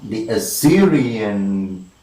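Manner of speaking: "Assyrian" slow, with a long stressed SYR; the-as-SYR, drawn out on the beat; metrical stress. Shown as a demonstration. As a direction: scan "The Assyrian" slowly, leaning on SYR. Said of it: "two unstressed followed by one stressed".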